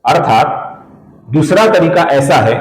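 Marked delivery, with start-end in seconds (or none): none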